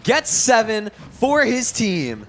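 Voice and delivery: shouting hype caster call; esports caster. Acted. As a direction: yell the clutch play-by-play.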